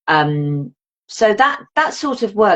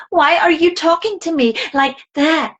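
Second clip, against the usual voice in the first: high, incredulous complaint; exasperated retort; 9 semitones higher than usual. Retold or imitated. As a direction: push back, fed up and high. Mocking the narrator's voice.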